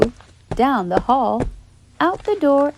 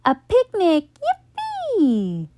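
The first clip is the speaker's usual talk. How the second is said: much higher, giddy squeal; excited; 11 semitones higher, slower than usual. A narrator's reading. Kids celebrating the picnic news, excited. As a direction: bounce with joy.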